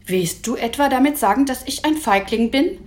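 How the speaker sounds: indignant acted challenge; affronted challenge. A narrator's reading. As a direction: demand an answer, spoiling for a fight. Traugott challenges Emil to a fight.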